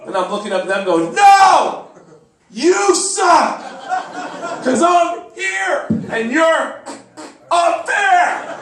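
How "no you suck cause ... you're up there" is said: high shouted comeback to a heckler; indignant, high-pitched holler; yelling back. Acted. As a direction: shout up to someone far above you.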